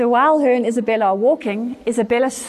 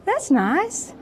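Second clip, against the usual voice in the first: high, hesitant polite reply; doubtful politeness; 7 semitones higher than usual. Retold or imitated. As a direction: humour her, pitched up and unconvinced. Mother's guarded reply to daughter's revelation.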